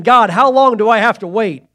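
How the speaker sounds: loud pleading cry to God. Pretend. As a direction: beg God out loud, impatient. Pleading prayer in sermon.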